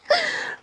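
high, giddy giggle; giddy laugh. Real, not acted. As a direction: burst of delighted high giggling. Giddy over the gift.

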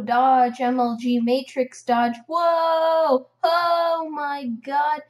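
loud, amazed outburst with a drawn-out whoa; excited gamer hype. Real, not acted. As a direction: hype up your own dodge, loud. Excited at dodging in game.

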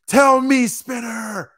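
high, hammed-up yell; shouted demand. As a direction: belt it out like a dramatic anime hero. Acts out character demanding answer.

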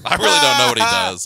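high, loud laughing reply. Real, not acted. Laughing off question about boss.

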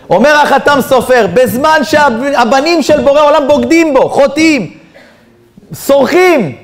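high-pitched, forceful sermon delivery; impassioned preaching. Real, not acted. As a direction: drive each accusation home from the pulpit.

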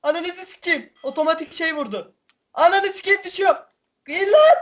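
high frantic cursing as he falls; shrill panicked swearing; panicked yelling. Real, not acted. Jet shot down, falling.

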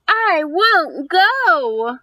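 put-on slow, drawn-out voice of a reluctant boy; stubborn little boy. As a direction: dig in your heels like a kid who won't go. Boy in book refuses school.

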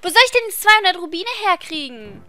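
exasperated wail. Real, not acted. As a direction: wail helplessly about the cost. Despairs over money needed.

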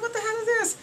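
high, incredulous squeal; high-pitched mock outrage. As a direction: squeal it in disbelief. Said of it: "I'm like".

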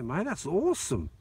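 ordinary talk

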